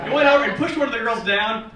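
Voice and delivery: ordinary talk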